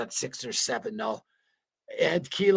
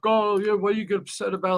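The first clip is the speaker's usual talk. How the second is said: higher-pitched mocking imitation; mock dismissive critics; 8 semitones higher than usual. Streamer mimicking people who question his grief, mock dismissive voice. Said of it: "So that bullshit, like".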